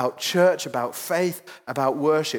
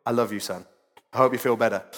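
tender to son; 8 semitones lower, faster than usual. Real, not acted. Speaking to hurt son in church.

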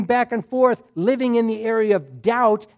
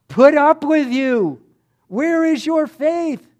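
higher, loud, drawn-out scolding; acted exasperation, voice raised; exasperated rebuke; 6 semitones higher, 5 dB louder, slower than usual. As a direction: scold like a master out of patience. Jesus rebuking disciples, continued.

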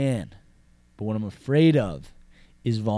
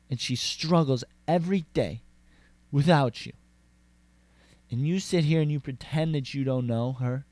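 halting, reproachful woman's speech; imitated indignant lecture; acted rant; 3 semitones higher than usual. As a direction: lay the guilt on him, pausing for weight. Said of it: "she rants about it".

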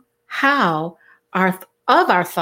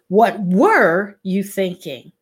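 "were" drawn out and punched, slow question; stress on WERE. Shown as a demonstration. Showing another emphasis in the question, slow with "were" stressed. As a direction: slowly ask it, leaning long on "were". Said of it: "Or you could say".

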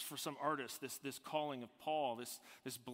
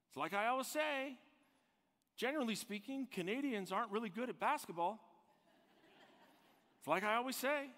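congregation member's sayings; 8 semitones higher, 4 dB louder than usual. Retold or imitated. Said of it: "But you know who else says that?".

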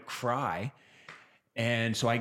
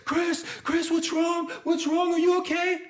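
much higher, louder pleading call; frantic girlfriend; 7 dB louder than usual. Retold or imitated. Girlfriend shaking her dazed boyfriend, in a high frantic call. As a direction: call his name like you're shaking him awake. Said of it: "she's shaking me".

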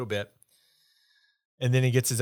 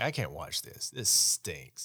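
low mutter; 3 semitones lower, 7 dB quieter, slower than usual. Retold or imitated.

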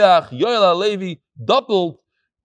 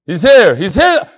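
excited spotting; 2 semitones higher, 7 dB louder than usual. Real, not acted. Spotting the email writer in crowd.

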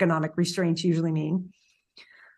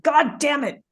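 mock angry curse; 6 semitones higher than usual. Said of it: "He's so mad".

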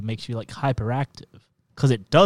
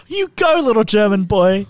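high cheer; 6 semitones higher than usual. Retold or imitated. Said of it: "And I'm like".

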